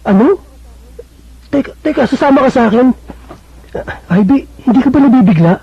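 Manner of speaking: he can't believe what he's hearing; startled disbelief. Acted. Vincent to Ivy, stunned she wants to come with him.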